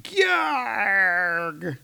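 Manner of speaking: Thor's cartoon war cry; mock strain grunt. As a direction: growl like pushing back a blast. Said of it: "leaning in and going".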